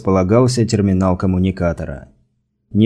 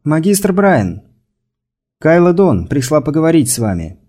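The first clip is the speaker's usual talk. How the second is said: raised voice, calling high through a sealed door; 7 semitones higher than usual. A narrator's reading. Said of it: "она позвала".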